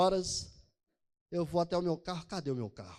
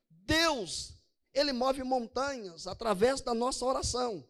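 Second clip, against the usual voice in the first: forceful preaching; 5 semitones higher, slower than usual. Acted. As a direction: preach it out with conviction. Pastor preaching to congregation.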